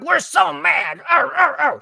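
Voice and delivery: high, loud mocking whine of angry protesters; mock-childish outrage. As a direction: sneer like a tantruming child. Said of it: "they're like".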